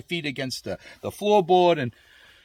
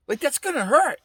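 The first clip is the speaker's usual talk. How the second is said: higher wince; 11 semitones higher than usual. Pretend. Picturing the airbag hitting his face, higher rising wince. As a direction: repeat it higher, with hurt rising at the end. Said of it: "And I used to think".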